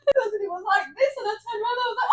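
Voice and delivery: high laughing delivery; talking through laughter. Real, not acted. Laughing while retelling mishap.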